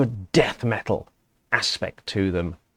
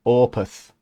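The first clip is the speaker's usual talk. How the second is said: put-on Yorkshire accent; 3 semitones higher, 5 dB louder than usual. Imitating his friend [name]'s Yorkshire "Opeth". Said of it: "he says it in a Yorkshire accent".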